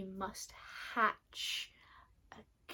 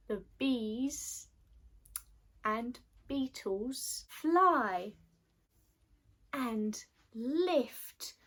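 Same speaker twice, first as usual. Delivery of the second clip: usual speaking voice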